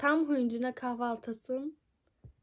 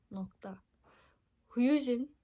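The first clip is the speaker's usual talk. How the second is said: normal talking voice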